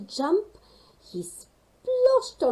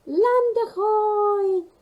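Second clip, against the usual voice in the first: very high, slow squeak; a tiny bird squeaks land ahoy; 12 semitones higher, 9 dB louder, slower than usual. Acted. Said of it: "squeaked the Snow Patrol".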